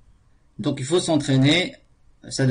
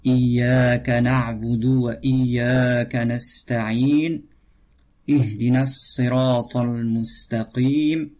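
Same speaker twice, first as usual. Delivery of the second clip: Quran recitation. Acted. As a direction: draw out each word as in tajwid recitation. Teacher recites Al-Fatiha.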